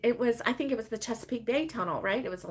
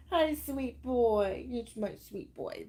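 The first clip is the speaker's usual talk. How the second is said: slow, soft pet talk; real doting murmur, trailing softer; tender cooing; 4 dB quieter, slower than usual. Real, not acted. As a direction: coo lovingly to a pet.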